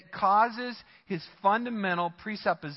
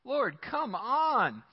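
slow plea to God; 3 semitones higher, much slower than usual. Retold or imitated. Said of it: "and he says".

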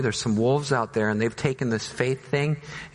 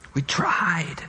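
whispered; 3 semitones higher than usual. Acted. Servants answering the ruler.

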